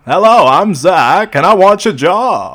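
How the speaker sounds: loud, high, slow mock Maine accent; hammed-up Maine accent. Co-host mocking Zach's job interview, broad Maine accent. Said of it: "Did you talk like this in your job interview".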